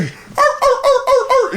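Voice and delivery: high yappy mock dog barks; mock barking dogs on the call; imitated dog barking. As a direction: bark like a little dog. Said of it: "So you're just hearing".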